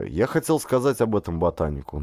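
plain reading voice

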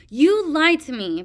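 high, loud shriek. A narrator's reading. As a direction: shriek the accusation. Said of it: "Peril shrieked".

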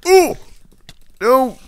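high, loud cry of alarm. Real, not acted. Gamer seeing a bad move, loud yelp. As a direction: yelp it as things go wrong.

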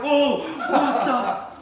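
high, drawn-out startled gasp of words; freaked-out retold yelp; startled whoa. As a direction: jump back in fright. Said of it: "Tim jumps back like".

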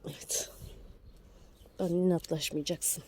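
usual speaking voice

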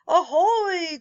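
storybook shout of discovery; cried out. Acted. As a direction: hail the sighting from the boat. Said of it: "cried Croissant".

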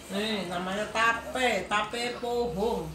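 chewing while speaking; humming with food in mouth; mouth full. Real, not acted. Seen 0: Left woman bites, holds food at mouth; chews while humming.

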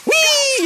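high, drawn-out "whee"; gleeful whee. Real, not acted. Streamer dodging the shark, gleeful squeal. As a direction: squeal it like sliding away.

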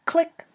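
clicked out loud and high; sharp click. Acted. Sound effect in story.

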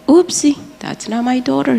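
high, light mock-disappointed brush-off. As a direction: toss it off like a let-down stranger.

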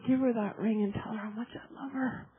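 very soft, low quote; tender retold message. God to a messenger about the ring, tender and hushed. Retells God's loving message.

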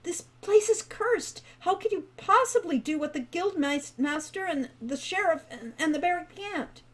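a frightened brother pleading, pitch spiralling up; rising panic. A narrator's reading. Falcon warning his brother, voice climbing in panic. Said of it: "his voice spiraling upward in panic".

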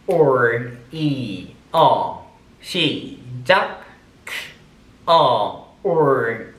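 each sound spelled out slowly and separately; sounding out phonetic symbols. Shown as a demonstration. Teacher drilling pronunciation sounds for the class, slow and deliberate. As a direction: drill the sounds one by one for students. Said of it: "발음 기호들을 붙여서 해보겠습니다".

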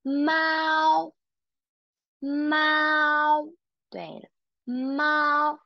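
猫 high and level, māo; teacher correcting pronunciation of 猫; first tone mao. Shown as a demonstration. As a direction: keep 猫 high and steady. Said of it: "It should be 猫".

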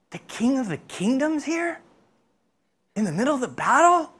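high-pitched hushed disbelief, rising at the end; astonished, voice lifted high; incredulous question. Acted. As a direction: play amazed that such a thing could be here. Acted disbelief at king being present.

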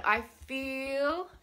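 "feel" held long; drawn-out word. Shown as a demonstration. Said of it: "draw out that word".

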